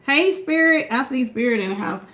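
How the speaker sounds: ordinary delivery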